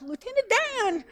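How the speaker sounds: very high hammed-up Forrest Gump call; high comic Gump cry; Forrest Gump impression. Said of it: "pulls a total Forrest Gump".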